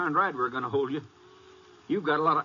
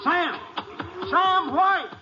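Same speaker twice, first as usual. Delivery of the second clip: much higher, louder yell to stop; shouting after him; 5 dB louder, much slower than usual. Acted. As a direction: yell across the yard to make him halt. Sheriff calls to fleeing suspect.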